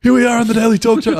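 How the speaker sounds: high, strained croak of a voice that's gone; lost voice imitation. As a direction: squeeze the words out as if your voice gave out. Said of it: "when you lost your voice".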